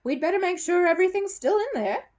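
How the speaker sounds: high, loud mock-helpful magician; showman's sneering aside; sarcastic. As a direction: drip sarcasm over the trick. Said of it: "he continued sarcastically".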